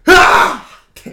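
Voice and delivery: a loud laughing unvoiced cry; replayed yell through laughter. Telling a friend how he screamed, laughing yell. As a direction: redo the yell, half laughing. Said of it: "自分でこんな声出るやと思って".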